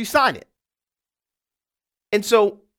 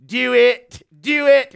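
mock British accent, a bit louder; 3 semitones higher, 6 dB louder, much slower than usual. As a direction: cheer him on in a light British accent. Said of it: "with his British accent".

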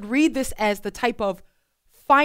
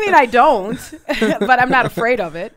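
laughing loudly while speaking; 7 dB louder than usual. Real, not acted. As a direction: talk through a burst of laughter. Laughing off nervous remark.

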